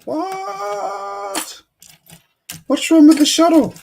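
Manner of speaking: surprised. Real, not acted. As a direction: react as if hurt by the question.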